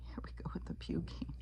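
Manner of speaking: hushed, breathy oh my Lord; whisper. Real, not acted. Vlogger watching a disgusting clip, whispering. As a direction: mutter it under your breath in disbelief.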